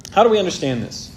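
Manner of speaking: ordinary delivery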